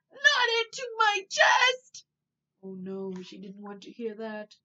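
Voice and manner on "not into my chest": high, loud, slow furious shout; fierce shout. A narrator's reading. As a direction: hammer out the refusal. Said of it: "roared Melinda fiercely".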